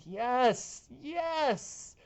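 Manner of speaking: high cheering yes; excited yes. Real, not acted. Forecaster cheering rain for drought, excited. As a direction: pump the fist on each yes.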